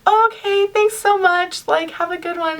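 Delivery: high, drawn-out polite thanks, softening at the end; nervously polite. Retold or imitated. Fan thanking a celebrity after selfie, high polite voice. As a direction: act out an awkward, over-sweet goodbye.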